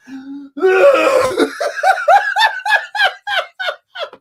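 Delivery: big high-pitched burst of laughter; loud high laugh. Real, not acted. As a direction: crack up loudly. Laughing at the movie.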